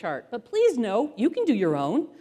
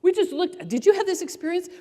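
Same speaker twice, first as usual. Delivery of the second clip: ordinary delivery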